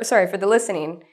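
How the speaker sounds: ordinary talk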